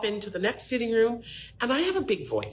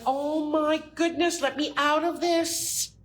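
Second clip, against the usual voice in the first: high, slow, mock plea of a tight bra; high drawn-out mock distress; mock squeezed customer; 7 semitones higher, slower than usual. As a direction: voice the trapped girls, pleading. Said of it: "And it's like".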